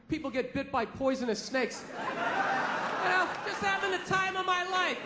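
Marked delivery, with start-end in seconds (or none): none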